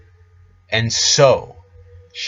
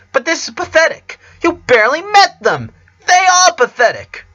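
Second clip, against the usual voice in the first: much higher, louder sneering shout; scornful shout; 6 dB louder than usual. Acted. Countess mocks Rara's friends in a high scornful shout. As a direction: spit the contempt out.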